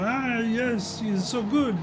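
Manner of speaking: put-on a goofy, half-baked Italian accent as the crowd; bad Italian accent. Racer mocking the crowd's praise in a bad Italian accent. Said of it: "That's my really bad Italian accent".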